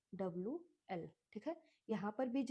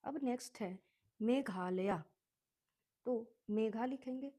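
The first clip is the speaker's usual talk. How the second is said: ordinary talk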